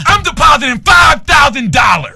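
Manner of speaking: slow, loud, high shout; loud yell. Retold or imitated. Customer yelling at the lady in the bank line. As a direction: announce it to the whole bank. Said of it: "So then I yell loud as fuck".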